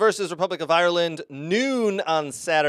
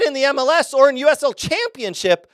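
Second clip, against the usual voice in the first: loud, high heated rant; 9 semitones higher, 7 dB louder than usual. Real, not acted. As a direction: let the frustration pour out.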